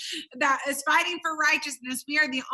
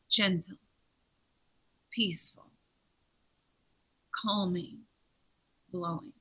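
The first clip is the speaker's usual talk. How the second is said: soft gentle hush; 7 semitones lower, 5 dB quieter than usual. Acted. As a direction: breathe each word out like a soft breeze. Describes gentle blowing of God.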